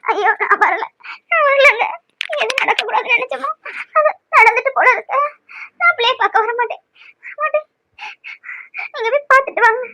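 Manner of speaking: high, tearful pleading; tearful refusal. Acted. Wife refusing to go see the twins, crying high. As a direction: refuse through tears.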